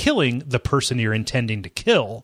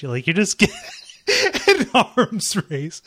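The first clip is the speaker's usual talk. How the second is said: laughing while talking; 8 semitones higher than usual. Real, not acted. Laughing through the words.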